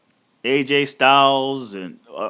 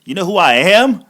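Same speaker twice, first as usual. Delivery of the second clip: mock outrage; 4 semitones higher, 7 dB louder than usual. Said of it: "I was like".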